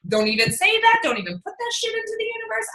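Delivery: half-joking rebuke; mock scolding. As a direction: shush a jinx quickly. Scolding friend for jinxing.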